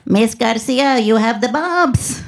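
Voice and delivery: put-on slow, foreign-accented little robot doctor; cute ESL-robot accent. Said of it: "sounded like a cute little robot took E S L classes".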